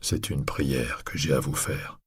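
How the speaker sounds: low, soft plea. Acted. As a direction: drop your voice to beg. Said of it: "en baissant la voix".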